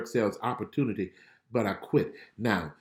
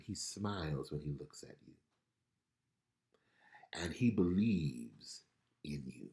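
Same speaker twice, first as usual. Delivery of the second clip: normal talking voice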